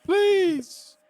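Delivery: frightened human prisoner pleading; begging prisoner. Acted. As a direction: beg for his life in a squeaky voice. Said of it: "So he's like".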